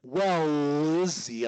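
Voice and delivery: "Well, Izzy" slowly fused, L held long; connected as one word. Shown as a demonstration. Coach demonstrating the linking trick, connected as one word. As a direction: hold the L in "Well" and glide into "Izzy". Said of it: "I'm connecting it as one word".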